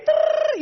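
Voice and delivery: a high machine-gun trill; rapid-fire rattle. Retold or imitated. Wife firing scolding at husband, rapid-fire rattle. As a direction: rattle it off like gunfire. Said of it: "היא יורה".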